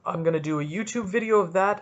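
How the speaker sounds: ordinary talk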